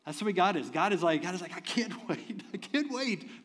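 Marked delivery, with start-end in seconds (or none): 1.6-3.4 excited. Pretend. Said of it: "God is so excited".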